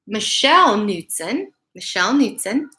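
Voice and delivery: plain reading voice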